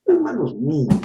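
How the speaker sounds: normal talking voice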